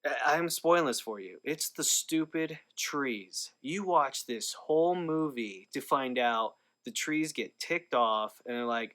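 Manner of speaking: usual speaking voice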